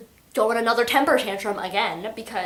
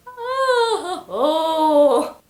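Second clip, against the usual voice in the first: high, drawn-out mock wail; imitated "oh" wail; 5 semitones higher, 5 dB louder than usual. Juliet reacts to Kenji, as a high mock wail. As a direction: wail it high, then louder and falling.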